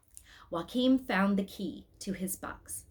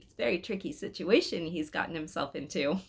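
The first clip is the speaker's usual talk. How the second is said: usual speaking voice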